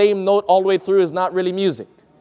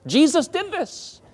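slow, high exclamation; high-pitched emphasis; 10 semitones higher, slower than usual. Shown as a demonstration. Preacher urging friends to follow Jesus' example, high emphatic voice. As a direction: lift the whole phrase high and stretch it.

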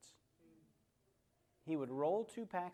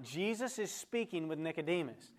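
ordinary talk, nothing marked

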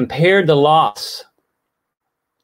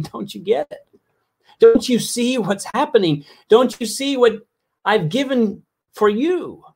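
crying out; 8 semitones higher than usual. Retold or imitated. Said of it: "I see God crying out to us".

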